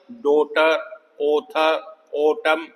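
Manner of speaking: "डॉटर, ऑथर, ऑटम" with long open O. Shown as a demonstration. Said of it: "long ओ की sound है भाई".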